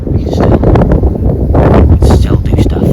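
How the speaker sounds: loud, rousing speech to the nation; rally them like a leader; impassioned speech. Acted.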